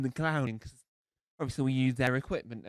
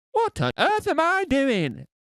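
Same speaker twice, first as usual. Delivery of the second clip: high, slow bewildered cry; baffled self; 11 semitones higher, 9 dB louder, slower than usual. Retold or imitated. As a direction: gasp at your own act in disbelief. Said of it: "I'm standing here going".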